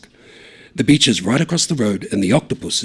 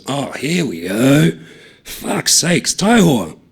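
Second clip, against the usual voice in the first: exasperated groan; 5 semitones higher, 4 dB louder, much slower than usual. Acted. Groaning at Taiho.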